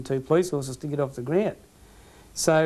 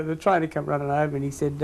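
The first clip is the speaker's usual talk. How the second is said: usual speaking voice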